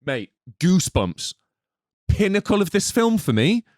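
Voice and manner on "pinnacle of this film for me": ordinary delivery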